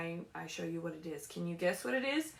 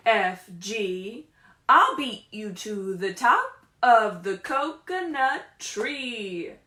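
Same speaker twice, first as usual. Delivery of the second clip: loud, slow, sing-song storybook read; reading to children; 2 semitones higher, 8 dB louder, much slower than usual. Acted. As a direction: read it big for the little ones. Reads picture book aloud.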